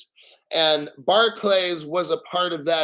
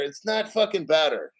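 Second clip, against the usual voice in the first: ordinary talk, nothing marked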